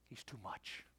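low, very soft near-whisper; hushed emphasis. Real, not acted. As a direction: drop right down for the punchline. Hushed answer to his refrain.

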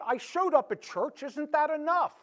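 high, loud put-on voice of a smug churchgoer. Pastor voicing a churchgoer's excuse, high and smug. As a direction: act out someone sure church is enough.

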